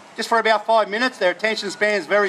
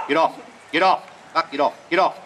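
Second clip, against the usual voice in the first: low drawn dog command; 6 semitones lower than usual. Real, not acted. Calling commands to working dog.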